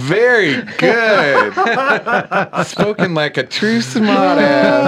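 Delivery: high, drawn-out words through laughter; laughing, slow and high-pitched approval; amused mock praise. Real, not acted. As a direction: congratulate slowly while laughing. Laughing applause for a joke.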